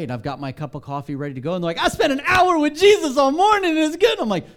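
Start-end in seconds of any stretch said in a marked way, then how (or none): 1.8-4.2 high, loud, sing-song mock of a cheerful colleague; chirpy morning person. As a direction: overdo the bright morning person. Mocking cheerful morning-people staff.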